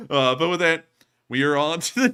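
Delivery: normal talking voice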